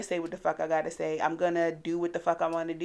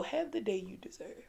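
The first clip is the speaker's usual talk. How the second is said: softer, dropped into a quiet menacing line; mild ominous hush; 3 semitones higher, 11 dB quieter than usual. Retold or imitated. Daughter imitating mom dismissing a rude stranger, quietly ominous. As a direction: let it sit like a quiet threat. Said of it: "the way she say it make you feel".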